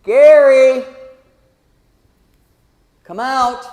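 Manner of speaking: very loud, high, drawn-out cry; acted summoning call. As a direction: call the dead out of the grave. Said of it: "you're gonna hear it from your grave".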